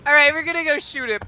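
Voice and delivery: high, loud eager call; eager gunner. Acted. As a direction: fire them up to shoot. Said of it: "she's like".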